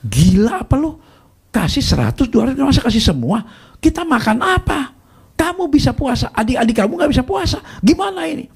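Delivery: high-pitched mock-angry rant; imitating furious sister. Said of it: "Wah, nu ngamuk dia".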